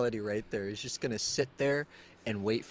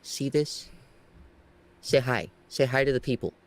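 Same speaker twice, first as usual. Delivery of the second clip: higher, louder coaxing to the cat; coaxing pet voice; 4 semitones higher, 5 dB louder than usual. Real, not acted. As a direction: sweet-talk the squirming cat into saying hi. Coaxing cat toward camera.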